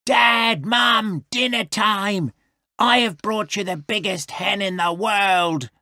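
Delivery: little wolf calling out; half-shouted announcement in a wolf voice; mild shout. A narrator's reading. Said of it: "he shouted".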